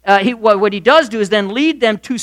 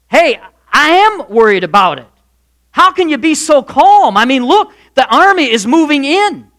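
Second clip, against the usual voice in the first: higher, louder anxious pleading; alarmed; 6 semitones higher, 5 dB louder than usual. Acted. Servant pleading with calm Elisha, alarmed and higher. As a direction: plead with him, rattled and frightened.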